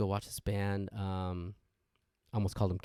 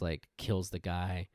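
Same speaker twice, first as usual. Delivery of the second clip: ordinary talk, nothing marked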